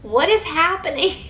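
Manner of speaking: high, baffled question; mock disbelief at the ending; retold bafflement. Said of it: "I was just like".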